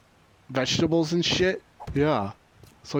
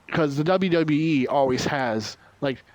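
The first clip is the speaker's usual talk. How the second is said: usual speaking voice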